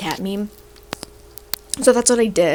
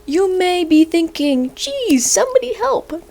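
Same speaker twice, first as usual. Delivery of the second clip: mock panicked meme voice pitched way up; climbing higher into a mock plea; reading the meme; 9 semitones higher than usual. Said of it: "It says".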